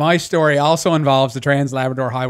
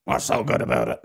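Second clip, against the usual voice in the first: much lower, a gruff smoker's grumble; raspy old smoker lady; 5 dB quieter than usual. Retold or imitated. Ferry clerk grumbles at a cheery greeting, low smoker's rasp. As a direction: growl it like a grumpy chain-smoking ferry clerk. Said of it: "she talks like that".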